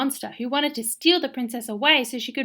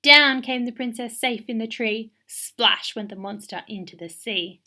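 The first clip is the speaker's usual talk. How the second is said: usual speaking voice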